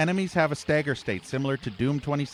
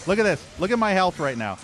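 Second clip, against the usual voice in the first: furious shouting; 10 semitones higher, 8 dB louder than usual. Real, not acted. Raging at unfair enemy fire.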